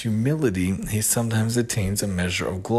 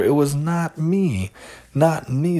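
high, slow, desperate denial; a young man protesting in panic; cried back; 6 semitones higher, 4 dB louder, slower than usual. A narrator's reading. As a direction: plead your innocence loudly and slowly. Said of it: "Zalman cried back".

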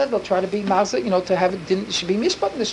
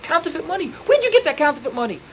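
angry demand; 7 semitones higher than usual. Retold or imitated. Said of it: "So he got so angry".

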